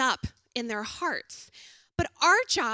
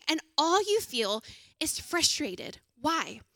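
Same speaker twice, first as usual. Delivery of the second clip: normal talking voice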